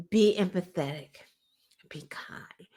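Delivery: soft whispered advice. Retold or imitated. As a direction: breathe it out softly. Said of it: "someone said".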